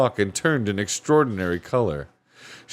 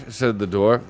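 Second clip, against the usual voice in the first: ordinary delivery, nothing marked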